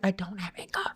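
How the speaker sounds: soft, tight-lipped mock denial; restrained denial. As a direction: deny it very softly, as if holding it in. First denial, held back.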